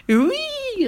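very high jubilant squeal. Acted. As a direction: crow with delight. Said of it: "en jubilant".

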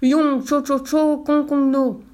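giant's high, loud angry bellow; mock Chinese yelled in great anger; furious shout. A narrator's reading. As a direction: shout each nonsense syllable in a rage. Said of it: "se mit à crier très fort".

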